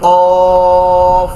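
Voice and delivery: কফ said loud from deep in the throat; deep-throat qaf. Shown as a demonstration. Teacher showing students the throat letter qaf, loud. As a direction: punch কফ from the root of the throat. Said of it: "একেবারে হলকের এখান থেকে".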